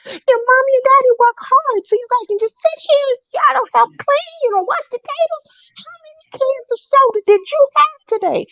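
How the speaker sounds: put-on high-pitched scolding scream at the kids; screaming rant. Nanny screaming at the kids about helping out. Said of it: "I'm screaming".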